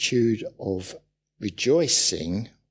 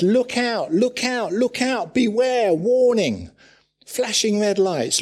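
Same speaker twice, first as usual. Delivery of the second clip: high, loud cry of alarm; alarmed warning; 10 semitones higher, 5 dB louder than usual. Retold or imitated. Preacher voicing Paul warning the church, loud alarm. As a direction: sound the alarm.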